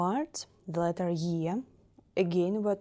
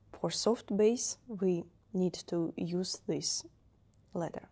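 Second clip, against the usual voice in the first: usual speaking voice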